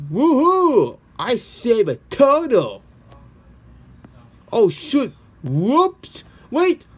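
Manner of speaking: high, loud whoop turning to alarm; celebrate, then catch yourself slipping; excited cheer. Acted.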